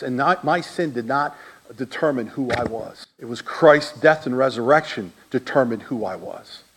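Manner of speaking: ordinary talk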